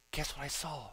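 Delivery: soft, low suspenseful question; acted-out hushed news. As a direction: share a secret discovery. Friend returns with secret news.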